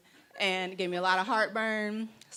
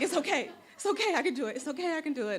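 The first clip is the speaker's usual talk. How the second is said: frazzled self-reassurance; 8 semitones higher, faster than usual. Acted. Frazzled mother reassuring herself.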